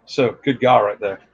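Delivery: ordinary delivery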